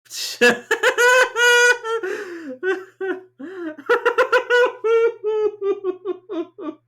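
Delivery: high, loud helpless laughter; wheezing shrieking laugh; hysterical laughter. Real, not acted. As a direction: crack up completely, crying with laughter.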